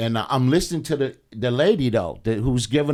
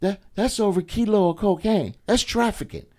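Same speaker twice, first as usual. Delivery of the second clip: imitating the lady; 7 semitones higher than usual. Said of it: "She said".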